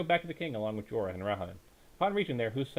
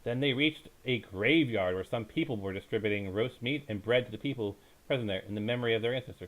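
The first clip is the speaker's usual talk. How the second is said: ordinary delivery, nothing marked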